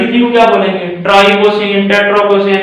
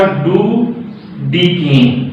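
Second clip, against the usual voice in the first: slow drilled answer; 4 semitones lower, much slower than usual. Shown as a demonstration. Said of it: "इसका नाम क्या हुआ".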